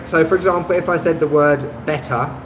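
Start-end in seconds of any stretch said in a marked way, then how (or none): 1.8-2.3 BETTER with a crisp, sounded T; Received Pronunciation. Shown as a demonstration. English teacher demonstrating the Queen's English, "better" with clear T. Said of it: "that's how the Queen would say it".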